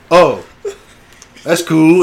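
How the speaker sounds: high, loud "oh" of mock retreat; sudden backing down. Kid faces tall Caleb, hastily backing down. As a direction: imitate a challenger hastily giving in.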